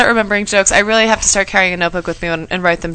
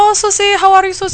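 put-on Indian accent; 12 semitones higher than usual. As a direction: greet cheerfully in an Indian accent. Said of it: "That was an Indian accent".

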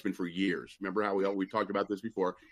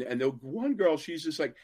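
ordinary talk

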